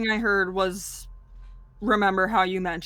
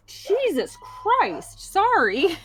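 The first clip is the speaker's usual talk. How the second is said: exasperated outburst; 9 semitones higher, 3 dB louder, much slower than usual. Real, not acted.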